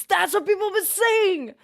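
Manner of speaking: high, loud burst of agreement; excited shout. Real, not acted. Streamer shouting agreement at chat, high and loud. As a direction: blurt it out thrilled, way up high.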